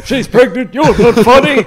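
laughing heckler parody; mock heckler. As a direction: parody the drunk's complaint.

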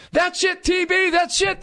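very high-pitched cheering shout; imitated excited yelling. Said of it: "he was yelling".

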